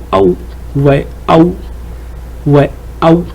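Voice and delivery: Aou modelled, a gliding into ou; teacher modelling Aou; demo syllable aou. Shown as a demonstration. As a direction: sound out Aou for students. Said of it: "qu'est-ce que je, je dis?".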